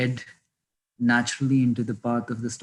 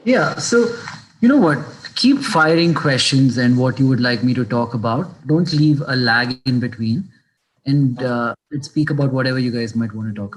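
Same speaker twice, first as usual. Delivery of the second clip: raised voice, fast near the end; speaking louder; 10 dB louder than usual. Real, not acted. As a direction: project so the caller hears. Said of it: "Sir, your voice is very low".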